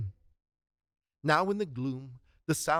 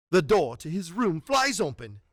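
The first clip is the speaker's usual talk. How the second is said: ordinary delivery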